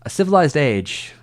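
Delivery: loud, slow, grand Jedi line; Obi-Wan impression. Streamer trying Obi-Wan's line, slow loud impression. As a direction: stretch the line out like a wise old knight. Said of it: "what would it be like?".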